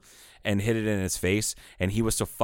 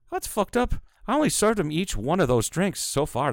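a light Irish brogue, pitched higher; imitating an Irish brogue; 10 semitones higher than usual. Retelling the Irish bartender's reaction in a light Irish brogue. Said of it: "[name]'s in his perfect brogue".

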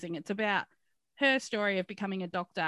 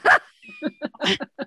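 high loud shriek of a laugh; burst of laughter; 14 dB louder than usual. Real, not acted. Big laugh about reading.

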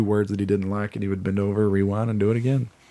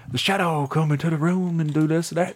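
high, put-on narrator voice; old radio narrator; 7 semitones higher than usual. Retelling old Shadow plays, imitated narrator voice. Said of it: "It was a voice just like that".

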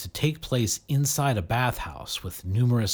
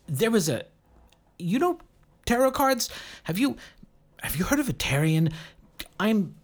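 halting, high, rushed confused man; a hint of a dazed stranger stammering; stammered uncomfortably; 7 semitones higher than usual. A narrator's reading. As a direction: keep stopping and restarting, lost. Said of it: "the man stammered uncomfortably".

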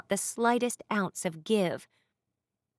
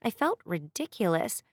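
ordinary delivery, nothing marked

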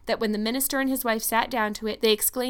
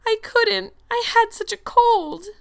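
high, loud, drawn-out wail; tearful; 13 semitones higher, 8 dB louder, slower than usual. A narrator's reading. Anne excuses herself to Marilla in tears, wailing. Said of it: "Anne dissolved into tears".

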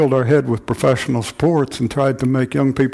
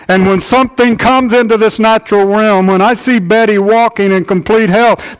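higher, louder fervent preaching; rally the men from the pulpit; preaching at full force; 7 semitones higher, 5 dB louder than usual. Acted. Pastor urging the men of the church, preaching at full force.

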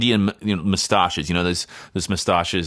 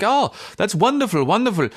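much higher imitated Indian accent; 7 semitones higher than usual. Factory owner eagerly invites the Australian to talk, Indian accent. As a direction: gush in a high Indian accent. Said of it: "Are you really doing the accent?".

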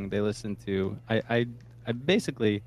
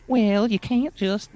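high, slow southern twang; southern twang skit voice; 11 semitones higher, 5 dB louder, slower than usual. Shown as a demonstration. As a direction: drawl it out like a goofy Southern character. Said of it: "like, that kind of voice".

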